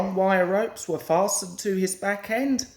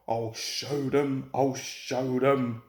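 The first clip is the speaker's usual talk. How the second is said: hissed; 7 semitones lower than usual. A narrator's reading. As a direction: hiss it spitefully. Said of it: "he hissed".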